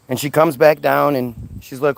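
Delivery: ordinary talk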